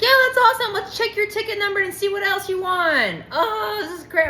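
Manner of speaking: high, gushing salesman voice pushing the prize; hyped salesman. Retold or imitated. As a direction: play an overeager pitchman gushing.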